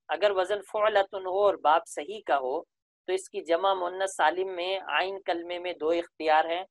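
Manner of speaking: usual speaking voice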